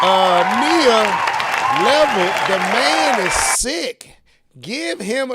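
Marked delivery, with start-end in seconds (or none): 0.0-4.1 raised voice to the room; loud call out. Real, not acted.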